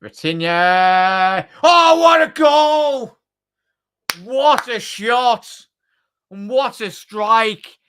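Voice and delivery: building from a drawn-out name into a huge high, slow goal shout; goal celebration. Real, not acted. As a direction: erupt like the whole stadium just saw a screamer. Goal scored, commentator erupts.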